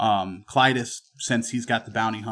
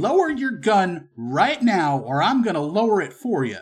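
stern gunman, threatening; 7 semitones higher, 3 dB louder than usual. Acted. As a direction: order the gun down, drawing the words out.

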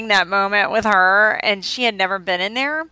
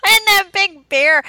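laughing; 11 semitones higher, 6 dB louder than usual. Real, not acted. Laughing recalling the bear.

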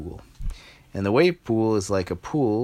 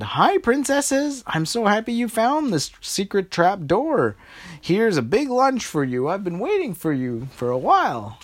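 beam with delight at your friends; cheerful boy; 11 semitones higher than usual. Acted. Liam welcoming the princesses to lunch, high and happy.

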